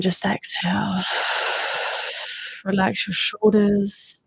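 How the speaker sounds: calm guide easing listeners into relaxation; guided meditation. Acted.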